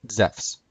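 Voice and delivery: ZEPHS as one syllable, zefs; modern Greek pronunciation. Shown as a demonstration. Presenter teaching Zeus's name, modern Greek pronunciation. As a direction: say Zephs with an f before s. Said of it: "Zeus would be pronounced Zephs".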